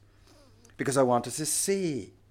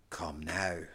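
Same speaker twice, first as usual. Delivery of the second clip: soft, breathy whisper of a gentle call; whispered invitation; 9 semitones lower, 11 dB quieter than usual. Acted. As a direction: whisper it like a tender summons.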